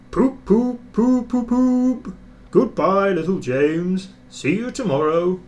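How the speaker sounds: high, loud mocking poop-poop; teasing whistle. A narrator's reading. Gordon teasing little James, high and loud. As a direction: toot and tease on the way out.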